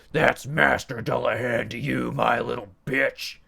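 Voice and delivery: loud gruff domineering demon. A narrator's reading. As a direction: put the servant in his place. Demon scolding.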